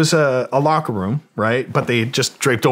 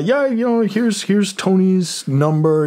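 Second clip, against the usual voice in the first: high, slow casual guy voice; imitating Tony; 10 semitones higher, slower than usual. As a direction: lift into a laid-back mock roadie. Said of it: "he's like, uh".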